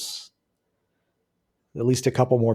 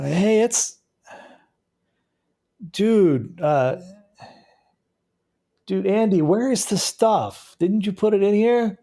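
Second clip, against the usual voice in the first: raised intruder voice; 9 semitones higher than usual. Acted. GM voices drug-seeking intruder.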